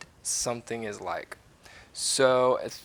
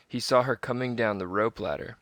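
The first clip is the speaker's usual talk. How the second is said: usual speaking voice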